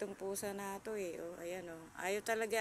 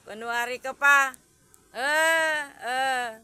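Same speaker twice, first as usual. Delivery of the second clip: loud teasing; 4 semitones higher, 13 dB louder, slower than usual. Real, not acted. Teasing the cat again.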